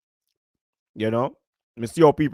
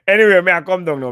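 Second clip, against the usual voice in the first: loud quick sign-off; 5 semitones higher, 8 dB louder, faster than usual. Real, not acted.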